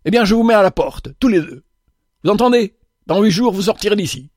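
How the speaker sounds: high, quick furious dismissal; irate boss blowing up; angry yell. A narrator's reading. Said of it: "hurla monsieur Lévy".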